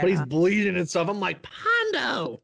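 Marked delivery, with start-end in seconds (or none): 1.4-2.4 high-pitched alarmed cry of a name; freaking out. Retold or imitated. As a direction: relive yelling in disbelief at the screen. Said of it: "freaking out when Pondo was taking".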